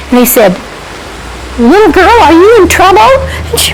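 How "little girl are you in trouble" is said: high-pitched hail from a boat; raised, carrying rescuer's voice; calling out. Acted. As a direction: call across the water to a child. Boatmen calling to stranded girl.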